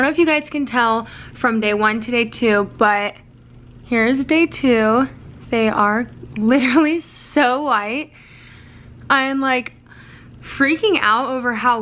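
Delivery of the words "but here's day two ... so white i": fingers stretching mouth open; speaking through stretched lips; talking with lips pulled back. Real, not acted. Seen 1: Both index fingers pull lips wide throughout, showing teeth while talking.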